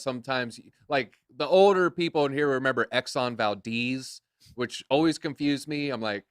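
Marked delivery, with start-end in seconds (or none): 2.9-4.2 Exxon Valdez with a long ee, "val-DEEZ"; common mispronunciation. Shown as a demonstration. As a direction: say Valdez to rhyme with "sneeze". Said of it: "My name's not Valdez".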